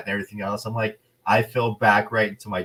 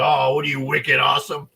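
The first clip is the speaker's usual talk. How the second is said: louder, higher Boston ribbing; mock Boston accent; 5 semitones higher, 5 dB louder than usual. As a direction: tease in an exaggerated Boston accent. Teasing waitress with Boston slang.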